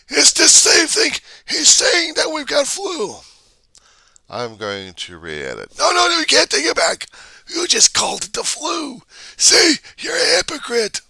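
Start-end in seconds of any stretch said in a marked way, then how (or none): 0.1-3.2 very high, loud, squawking voice; screeching mock accuser. Vlogger mimicking online mob shouting at author. 5.8-11.0 very high, loud, squawking voice; screeching mock accuser. Vlogger mimicking online mob shouting at author.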